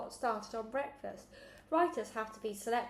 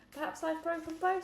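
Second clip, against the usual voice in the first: insistent; 8 semitones higher than usual. Acted. Alexander insists he's hurt.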